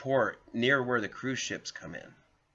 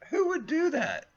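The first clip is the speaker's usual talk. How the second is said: mock disbelief; 4 dB louder than usual. Mocking own blunder.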